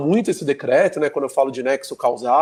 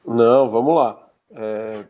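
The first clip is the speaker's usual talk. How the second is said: congested allergy voice; blocked nose; 3 semitones lower, 4 dB louder, much slower than usual. Real, not acted. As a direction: speak through a stuffy nose. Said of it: "você tá péssimo dessa sua alergia".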